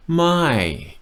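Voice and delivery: slow, My drawn out and falling; slow stressed my. Shown as a demonstration. Teacher stressing the Thai word My for learners. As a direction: linger on My with a fall. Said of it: "my falling tone".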